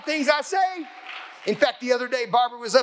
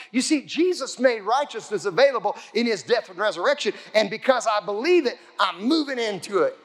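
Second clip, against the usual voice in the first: usual speaking voice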